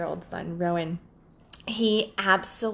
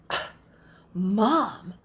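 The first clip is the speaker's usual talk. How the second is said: imitating disgust. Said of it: "looks at me with utter disgust".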